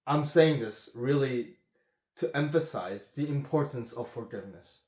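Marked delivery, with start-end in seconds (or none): none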